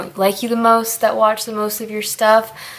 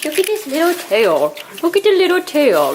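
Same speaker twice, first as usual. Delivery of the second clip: high cooing at a pet's tail; 8 semitones higher than usual. Real, not acted. Cooing over pig's tail.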